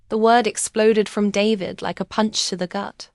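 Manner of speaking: plain reading voice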